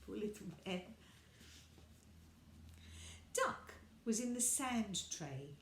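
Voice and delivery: usual speaking voice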